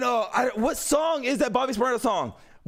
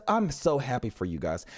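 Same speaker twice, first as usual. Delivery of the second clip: very low, understated congratulations; deep-voiced mock-polite well-wishing; feigned happiness; 12 semitones lower, 3 dB quieter than usual. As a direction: drop the pitch way down, politely backing away. Acted polite excuse to leave.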